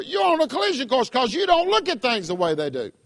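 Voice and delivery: ordinary delivery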